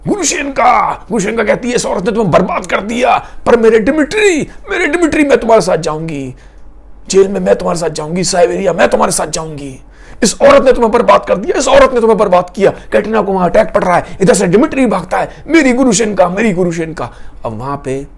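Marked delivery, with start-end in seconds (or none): 0.1-12.7 high, loud, breathless devotion; passionate outcry. Acted. Grushenka running to Dimitri vowing to follow him, impassioned. 15.5-17.1 calling out. Acted. Dimitri calling out to Grushenka.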